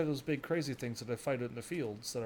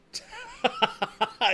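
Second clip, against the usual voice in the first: laughing; 7 semitones higher, 6 dB louder than usual. Real, not acted. Laughing at old man.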